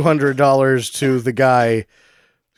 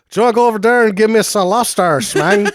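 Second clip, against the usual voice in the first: high, exaggerated Spanglish accent; mock Spanglish accent; 9 semitones higher, 4 dB louder than usual. Imitating East Side's lines, high Spanglish accent. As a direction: ham up a Spanglish movie accent. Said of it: "this guy's definitely got deeper Spanglish than East Side".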